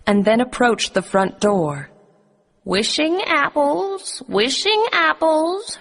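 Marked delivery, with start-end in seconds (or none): 2.6-5.8 put-on old peddler woman calling, much higher and slow; disguised queen as an old crone, crying her apples; crying out wares. A narrator's reading. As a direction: hawk the apples in a high put-on old-lady voice. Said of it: "she cried".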